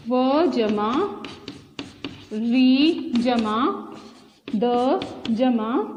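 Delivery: each jama said very slowly; slow spelling. Shown as a demonstration. Teacher spelling a word slowly for children. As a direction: spell out the parts at a slow pace. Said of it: "उदाहरण देखते हैं आगे".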